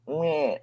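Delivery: short, high whimper; imitated baby cry. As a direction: mimic a child whimpering in his sleep. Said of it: "he'll just start crying".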